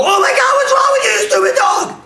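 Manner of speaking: high, hysterical yell; hammed-up shriek at full volume; freaking out. Shown as a demonstration. Owner yelling at a dog that peed, freaking out. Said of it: "you wouldn't freak out".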